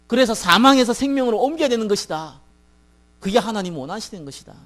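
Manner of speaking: declaring the gospel with mounting force; forceful preaching. Retold or imitated. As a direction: preach it hard, point by point.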